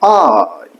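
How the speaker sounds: slow, stretched "আই" with a falling glide; long drawn-out vowel. Shown as a demonstration. Teacher modelling the letter for learners, elongated "আই". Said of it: "একটু টেনে পড়তে হবে".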